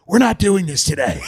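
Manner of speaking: hammed-up defiant mayor snapping at press; mock shout. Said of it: "she'd be like".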